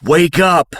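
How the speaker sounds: high, loud shout of wake up. Acted. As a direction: jolt him awake with a yell. Dream voice shouting him awake.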